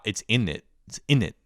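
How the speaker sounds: "in it" said as innit, no z or t in the middle; run-together innit. Shown as a demonstration. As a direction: insist on slurred "in it". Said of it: "You gotta say, 'In it.'".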